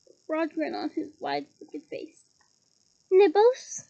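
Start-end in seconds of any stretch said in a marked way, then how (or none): 3.1-3.8 sugary high offer of nibbles; a sly, sweet-voiced poltergeist offer. Poltergeist sweetly offering party guests nibbles, mock-sweet. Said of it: "he said sweetly".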